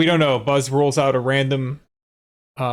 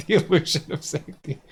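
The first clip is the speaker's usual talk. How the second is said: laughing; 3 semitones higher than usual. Real, not acted. Laughing repeat of game text.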